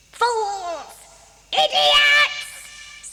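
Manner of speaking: furious screech. A narrator's reading. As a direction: Holler losing his temper at the trolls. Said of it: "screeched Holler".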